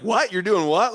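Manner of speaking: a stunned crewman's "what?"; shocked disbelief. Retold or imitated. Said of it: "they're like".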